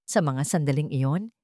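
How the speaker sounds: ordinary delivery, nothing marked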